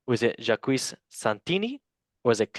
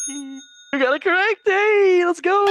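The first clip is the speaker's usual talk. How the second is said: high, loud celebratory shout; excited cheer; 6 dB louder than usual. Real, not acted. Quiz host celebrating player's right answer, excited high shout. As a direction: whoop it up, pitch soaring.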